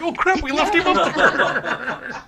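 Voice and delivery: high, loud, slow laughing dismay; laughing alarm. Retold or imitated. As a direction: realize with a laughing gasp. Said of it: "It's like".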